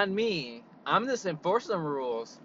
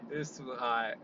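ordinary delivery

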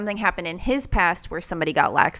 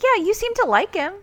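mock Sherlock; 13 semitones higher, 6 dB louder than usual. Sherlock quoted.

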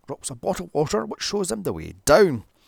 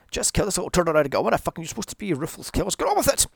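hurrying the killers along, furious; impatient rant; 4 semitones higher, much faster than usual. Real, not acted. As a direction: rattle it off, fed up, rising to a yell. Yelling at villains onscreen.

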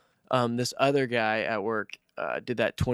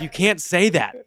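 louder, higher mock reprimand; retold scolding; 5 semitones higher, 6 dB louder than usual. Man retelling how he corrected his coworker, scolding. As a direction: tell him off, raised and insistent.

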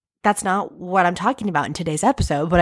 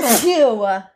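loud full sneeze; real sneeze; 4 semitones higher, 3 dB louder than usual. Real, not acted. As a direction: let out a big sneeze. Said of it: "And I have to sneeze".

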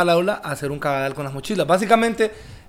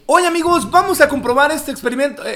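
higher, hyped product-tester voice; Mexican YouTuber voice; 8 semitones higher than usual. Retold or imitated. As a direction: imitate an eager reviewer host. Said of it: "él habla bien raro".

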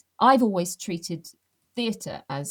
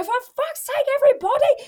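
exasperated stage shout; 7 dB louder than usual. Pretend. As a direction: bellow at the audience in despair. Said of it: "You go".

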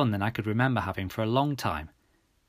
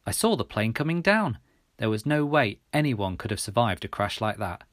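ordinary delivery

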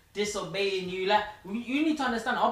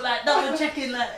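ordinary talk, nothing marked

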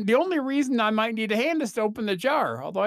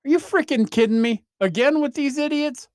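realistic annoyed hero; 8 semitones higher, 4 dB louder than usual. Retold or imitated. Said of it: "Like that".

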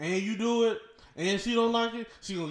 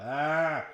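mock climax moan; 3 semitones lower than usual. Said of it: "you be like".

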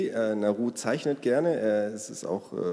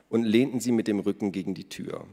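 plain reading voice

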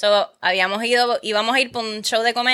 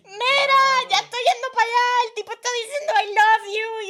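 high, breathless mock panic; telling her cousin in a panic; panicked report; 11 semitones higher than usual. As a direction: rush it out frightened. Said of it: "y yo".

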